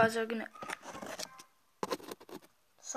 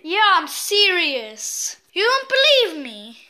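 loud, high, rattled pleading; agitated insisting; 5 semitones higher, 10 dB louder than usual. Real, not acted. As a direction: insist nobody believes you. Insisting to Barky, shaken.

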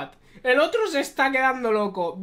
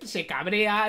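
normal talking voice

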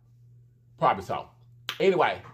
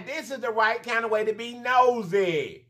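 normal talking voice

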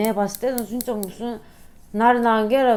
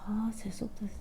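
unvoiced teasing whisper; 14 dB quieter than usual. Real, not acted. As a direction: whisper it jokingly. Teasing aside to husband.

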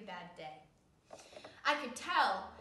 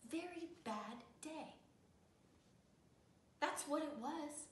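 ordinary delivery, nothing marked

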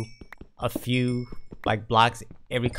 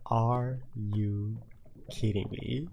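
ordinary talk

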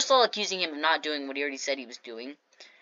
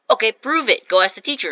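retold challenge; 5 semitones higher, 7 dB louder than usual. Retold challenge from doubting classmate.

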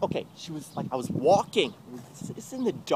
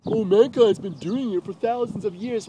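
higher, louder mock argument; mocking quote; 4 semitones higher, 5 dB louder than usual. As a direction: parrot their argument with scorn. Mocks a common argument.

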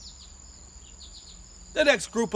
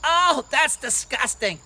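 slow, drawn-out revulsion, raised; disgusted kids; 5 semitones higher, 7 dB louder, slower than usual. Acted. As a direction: recoil like grossed-out children. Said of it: "some of the kids".

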